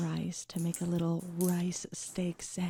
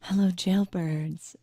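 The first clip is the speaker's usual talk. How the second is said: slow announcer greeting; 7 dB louder, slower than usual. Acted. ASMR-style product intro.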